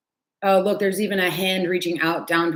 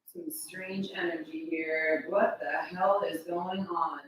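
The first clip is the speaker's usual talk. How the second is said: much softer, slow, under her breath; hushed aside; 10 dB quieter, slower than usual. Real, not acted. Searching the house for her cat, soft slow murmur.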